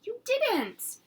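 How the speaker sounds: a mom who can't believe it; shocked mother. Acted. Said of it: "my mother cried".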